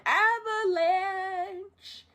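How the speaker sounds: high, hugely drawn-out call; mock warning cry. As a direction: sing it out slowly like an alarm.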